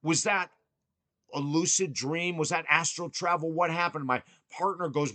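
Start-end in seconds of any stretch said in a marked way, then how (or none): 0.0-4.1 retold scream. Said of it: "and I screamed".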